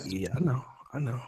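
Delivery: low, soft, offhand murmur; mock nonchalance. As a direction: play it modestly unimpressed. Mimics laid-back quiet guest.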